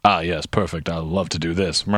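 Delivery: low-pitched imitation of a British club official; Higgins. Host voicing Higgins relishing a complaint, lightly mocking low voice. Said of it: "So Higgins goes".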